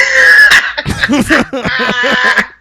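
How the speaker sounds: loud high laughter; burst of laughter. Real, not acted. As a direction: laugh hard at own line. Laughing after the quote.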